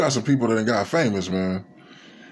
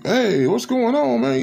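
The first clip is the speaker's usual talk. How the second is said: friendly neighbor; slower than usual. Retold or imitated. Neighbor greets you outside.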